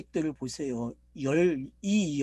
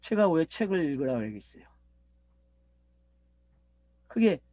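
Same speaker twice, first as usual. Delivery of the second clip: ordinary talk, nothing marked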